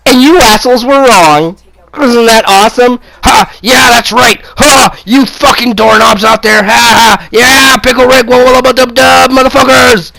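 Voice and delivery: triumphant jeering at the haters; gloating shout. Real, not acted. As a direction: crow over them, taunting. Gloating at doubters after being proved right.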